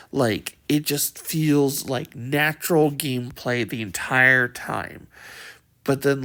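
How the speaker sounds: usual speaking voice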